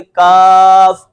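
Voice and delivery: کاف drawn out very slowly with a thin front k; light kaf. Shown as a demonstration. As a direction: stretch the کاف, light and forward. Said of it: "باریک ڈنڈے والا کاف جو ہے اس کو ہم کیسے پڑھیں گے؟".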